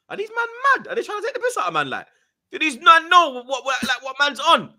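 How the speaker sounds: very high retold indignation; indignant mates. Mates reacting to people staring at him, indignant. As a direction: bristle in high-pitched disbelief.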